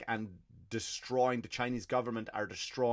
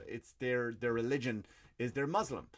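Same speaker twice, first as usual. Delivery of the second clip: ordinary talk, nothing marked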